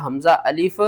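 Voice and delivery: very slow, drawn-out syllables and verse; slow recitation. Shown as a demonstration. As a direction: stretch the madd as far as it goes. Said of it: "کس طرح؟".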